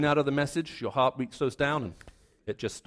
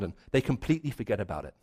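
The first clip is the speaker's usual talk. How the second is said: normal talking voice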